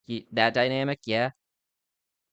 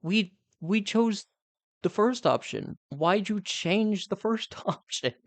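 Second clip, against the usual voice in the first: high, slow, incredulous complaint; exasperated disbelief; 6 semitones higher than usual. Retold or imitated. Outvoted sibling questioning dad's swap, high exasperated disbelief. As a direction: drag it out in disbelief, pitched up. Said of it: "it's just like".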